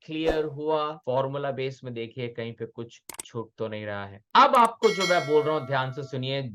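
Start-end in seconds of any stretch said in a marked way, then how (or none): none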